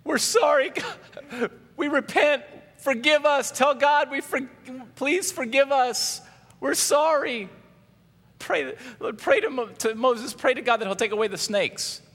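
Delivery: high, loud mock pleading; crying out. The people begging Moses to pray, crying out. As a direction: beg frantically in a high put-on voice. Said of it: "the people came to Moses and cried out".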